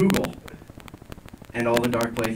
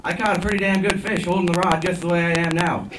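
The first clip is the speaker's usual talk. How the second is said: higher-pitched, half-yelled boastful reply; lightly raised, hollering-back voice; mock yell back; 5 semitones higher than usual. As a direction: call it back up, playing at yelling. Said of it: "I can now yell back up to him".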